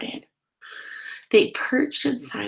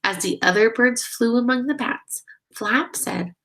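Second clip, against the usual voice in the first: usual speaking voice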